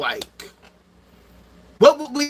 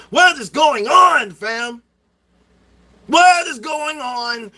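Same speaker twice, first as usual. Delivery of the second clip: loud, slow, outraged shouting at the sky; exasperated shout; 5 semitones higher, 4 dB louder, slower than usual. Real, not acted. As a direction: shout it out in disbelief, dragging each word. Ranting about getting few likes.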